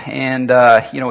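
ordinary talk, nothing marked

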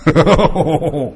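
short laugh at himself; self-conscious chuckle. Real, not acted. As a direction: laugh off scaring yourself. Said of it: "I scared myself".

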